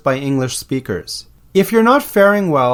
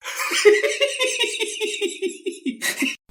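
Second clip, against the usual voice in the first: amused laugh; 8 dB quieter than usual. Real, not acted. Laughs at own silly reply.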